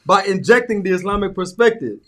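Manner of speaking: host intro. Acted. Host opening the podcast for listeners, upbeat announcer. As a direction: open the show like a hype host. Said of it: "Welcome to Amu Yum Yum the Pod".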